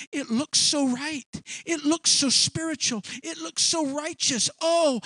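lightly mimicked gullible believer. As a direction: gush as if taken in. Acts out easily fooled churchgoers.

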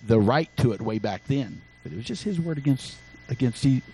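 normal talking voice

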